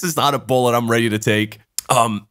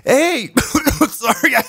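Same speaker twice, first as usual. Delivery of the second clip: much higher, chirpy woman's voice breaking into a laugh; mock woman voice; 12 semitones higher, slower than usual. Mimicking the woman cutting off his friend, high and giggling. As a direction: squeak it out like her, then lose it laughing.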